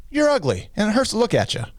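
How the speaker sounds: very high parrot voice; parrot insult. Acted. Parrot insulting the woman, high parrot voice. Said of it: "Parrot says".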